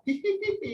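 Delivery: mock high-pitched giggle. Shown as a demonstration. Teacher demonstrating a giggle to the class, high mock giggle. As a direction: titter it up high. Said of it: "pula dia gelak".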